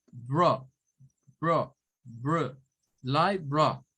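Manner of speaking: BRO isolated, then LIE BRO as "lai-bro"; syllable demo. Shown as a demonstration. Teacher building the word bit by bit, syllable demo. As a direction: chop the word into separate syllables. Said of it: "Esta es otra sílaba".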